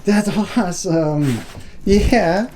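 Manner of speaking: high, loud and drawn out; excited dog praise. Real, not acted. Owner hypes up his dog at the bed, excited praise. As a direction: gush over the dog's bed.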